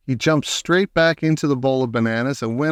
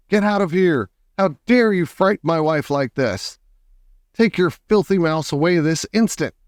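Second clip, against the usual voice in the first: high, outraged ordering out; indignant husband, raised pitch, moderate volume; angry shouting; 6 semitones higher than usual. A narrator's reading. Husband defends his frightened wife, angry shouting. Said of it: "towering over us and shouting".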